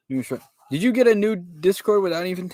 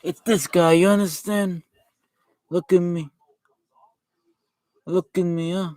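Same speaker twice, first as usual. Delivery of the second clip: pitched-up mafia don; Vito Corleone. Acted. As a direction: hold a comic godfather impression through a rambling grievance. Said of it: "This is the Godfather from The Godfather".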